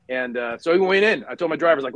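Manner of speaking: ordinary delivery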